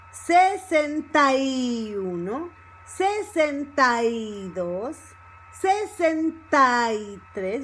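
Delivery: "sesenta y" as one word, "ta-y" held long; stress on second syllable. Shown as a demonstration. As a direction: lean slowly on the joined "tai" in "sesenta y". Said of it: "in the second syllable".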